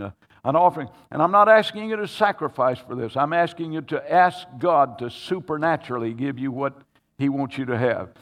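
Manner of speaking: rally the congregation from the pulpit; fervent preacher exhortation; preaching. Acted.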